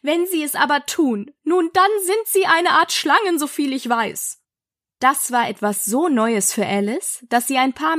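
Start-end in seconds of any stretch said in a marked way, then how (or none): 0.0-4.4 higher, lightly voiced accuser; see through the fib; suspicious pigeon. A narrator's reading. Pigeon suspects Alice of inventing, suspicious.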